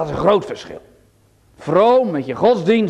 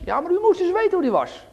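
objecting churchgoer; 11 semitones higher, faster than usual. Pretend.

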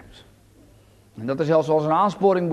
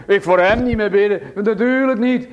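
quoted excuse; 4 dB louder than usual. Pretend. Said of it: "Als je zegt".

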